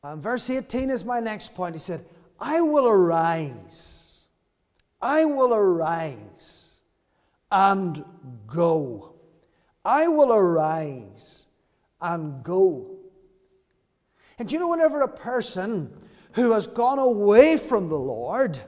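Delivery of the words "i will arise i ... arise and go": slow, deliberate, each phrase weighted; slow resolve. Acted. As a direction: say it like a vow, step by step. Preacher voicing the son's resolve.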